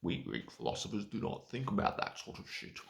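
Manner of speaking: loud, lecturing ancient philosopher; pompous Aristotle. Retold or imitated. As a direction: boom it out like a smug philosopher. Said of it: "That's the sort of shit they probably thought".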